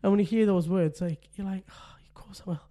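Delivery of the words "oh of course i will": tender soft reply. Retold or imitated. Son asks dad to play.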